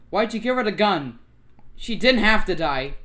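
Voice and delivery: acted distress, voice lifted; upset accusation. Acts an accusing, upset character.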